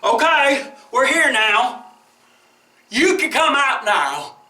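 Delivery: calling out. Acted. Whale calling to man.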